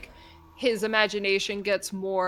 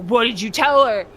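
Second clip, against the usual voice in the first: suspicious dad; 8 dB louder than usual. Retold or imitated. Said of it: "he was like".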